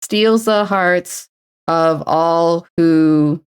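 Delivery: very slow drawn-out words; slow syllable count. Shown as a demonstration. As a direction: tap out each syllable. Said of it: "Need four syllables".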